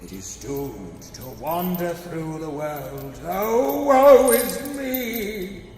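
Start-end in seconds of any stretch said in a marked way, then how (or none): 3.2-5.6 high, loud, slow wail; hammed-up ghostly wail of woe; wailing lament. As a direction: cry out in ghostly grief.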